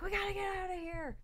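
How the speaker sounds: high-pitched playacted escape cry; mock panicked urgency. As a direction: imitate someone desperate to get out. Voicing character fleeing.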